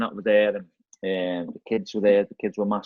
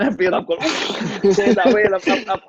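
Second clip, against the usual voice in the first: laughing; 10 semitones higher, 6 dB louder than usual. Retold or imitated. Fleeing the famous manager in panic.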